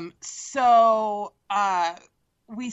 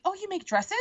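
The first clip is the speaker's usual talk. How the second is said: Ariel's surprise; 10 semitones higher, 5 dB quieter than usual. Retold or imitated. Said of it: "she's like".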